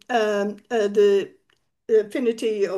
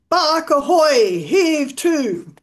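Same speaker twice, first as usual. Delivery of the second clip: mock shout through a speaking trumpet, slow; loud hail; 5 semitones higher, 6 dB louder, much slower than usual. Stone hails the ship through a trumpet, shouting. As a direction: call out across the water. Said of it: "shouts through the trumpet".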